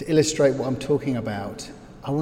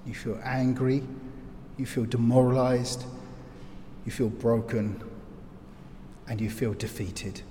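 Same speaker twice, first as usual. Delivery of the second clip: ordinary delivery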